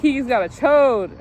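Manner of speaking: retelling a classmate's loud teasing chant; schoolyard taunt. Retold or imitated. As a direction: taunt her like a jeering teenage boy. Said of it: "he was like".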